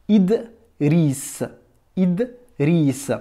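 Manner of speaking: Idriss split as Id, riss, with a bare d; consonant without vowel. Shown as a demonstration. Teacher demonstrates the zero vowel, saying Idriss split after d. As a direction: stop Idriss on the d, then say riss slowly. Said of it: "tu prononces uniquement le son de la consonne".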